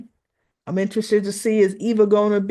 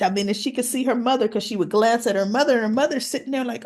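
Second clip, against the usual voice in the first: ordinary talk, nothing marked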